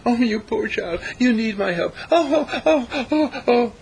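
high, loud mock-sobbing pity; sentimental pity. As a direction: ham up a weepy tender-hearted rescuer. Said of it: "What is sentimentality?".

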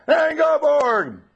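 long, very high, loud shout of the name. Retold or imitated. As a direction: yell the name across the room. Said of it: "Finally, I'll shout".

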